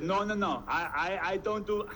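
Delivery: hammed-up Russian accent with stumbling starts; halting mock-Russian refusal; bad Russian accent. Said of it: "this is my very bad Russian accent".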